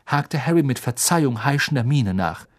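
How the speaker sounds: ordinary talk, nothing marked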